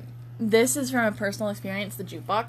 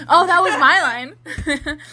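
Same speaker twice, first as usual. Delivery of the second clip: higher, louder, slow mock indignation; mock protest; 6 semitones higher, 6 dB louder, slower than usual. Reading her own story line aloud, mock protest. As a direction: protest playfully, stretching it out. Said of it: "I say".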